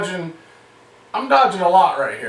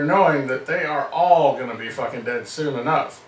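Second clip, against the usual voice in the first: ordinary talk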